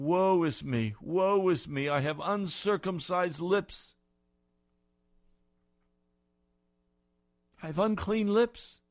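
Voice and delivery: loud, high wail of woe is me; anguished cry of Isaiah. Acted. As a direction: cry out undone before God. Isaiah's cry voiced by preacher.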